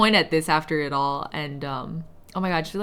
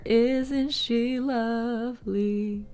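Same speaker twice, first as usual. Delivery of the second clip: doting; 3 semitones higher, 3 dB quieter, much slower than usual. Real, not acted. Doting over finished piece.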